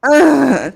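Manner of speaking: mock someone's heavy breath as a moan; exaggerated breathy groan; imitated breathing. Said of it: "Just breathing like".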